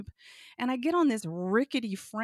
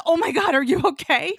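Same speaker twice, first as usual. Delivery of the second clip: loud, high panicked question; acted-out horrified concern; alarmed check-in; 7 semitones higher, 12 dB louder than usual. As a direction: cry out in hammed-up alarm, rising at the end.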